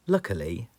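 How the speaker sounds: ordinary delivery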